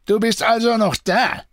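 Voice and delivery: angry snarl. A narrator's reading. Deserter rounds on the bound prisoner, angry snarl. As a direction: go at the captive angrily, pitch high. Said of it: "fuhr er Benoit böse an".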